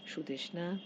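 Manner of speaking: plain reading voice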